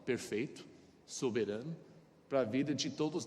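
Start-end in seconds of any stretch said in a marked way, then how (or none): none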